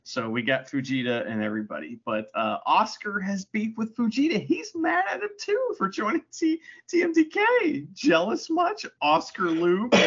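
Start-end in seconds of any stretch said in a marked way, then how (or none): 2.7-9.9 high-pitched teasing jeer; playful jeering in a raised voice; mock taunting. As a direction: taunt him like a playground tease. Teasing a jealous wrestler.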